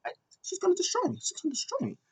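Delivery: hammed-up terrified Lana; mock panic. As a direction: panic it out in a squeaky rush. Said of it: "Lana was like".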